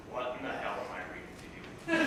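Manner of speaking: ordinary talk, nothing marked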